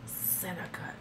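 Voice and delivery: breathy creepy whisper; soft mock come-on, whispered; whispered imitation. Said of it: "whispered creepy imitation".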